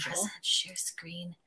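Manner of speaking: soft, slow whispered aside; hushed, slowed-down mutter. Real, not acted. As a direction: whisper it to yourself. Aside while sharing her screen.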